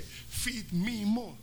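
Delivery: very slow, stretched-out wrestler voice; wrestler growl. Retold or imitated. Preacher imitating a WWF wrestler, slow and stretched. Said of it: "un luchador que dice que".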